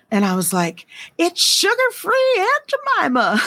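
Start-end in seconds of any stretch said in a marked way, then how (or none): 1.2-3.4 high, drawn-out line breaking into laughter; retold punchline through laughter. Wife retells her joke to husband, high and laughing. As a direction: say it slowly, high-pitched, cracking up.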